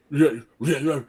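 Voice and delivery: mock flustered stammering; imitated speechless sputter. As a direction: imitate a man too worked up for sentences. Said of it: "can't even f-, function with a complete sentence".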